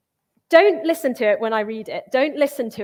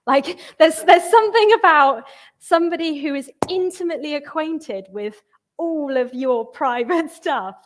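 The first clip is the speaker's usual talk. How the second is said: excited; 4 semitones higher, 6 dB louder than usual. Real, not acted. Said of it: "animated point about exposure".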